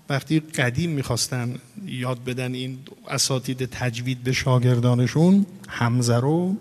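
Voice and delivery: ordinary delivery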